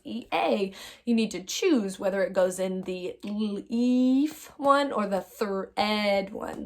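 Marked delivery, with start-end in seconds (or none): 3.2-4.5 "leaf" stretched into l, long eef; long E, segmented. Shown as a demonstration. Teacher reminding pupils to choose a column, long E stretched. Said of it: "the long E or the short E". 5.2-6.3 "thread" split slowly into thrr, ed; short E, segmented. Shown as a demonstration. Teacher reminding pupils to choose a column, short E segmented. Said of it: "the long E or the short E".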